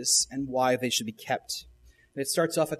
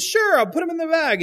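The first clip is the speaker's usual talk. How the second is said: put-on much higher, louder little-girl voice; high chirpy child; 13 semitones higher, 6 dB louder than usual. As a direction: chirp it like a happy kid. Bluey answers her dad.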